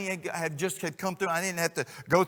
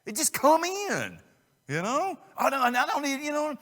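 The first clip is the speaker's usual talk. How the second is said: mock protest; 12 semitones higher, 4 dB louder than usual. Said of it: "And we're like".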